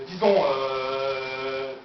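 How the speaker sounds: very slow, low, endlessly drawn-out "euh"; low droning hesitation while stuck; stalled thinking. Acted. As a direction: hang on the hesitation. Stuck searching for a solution.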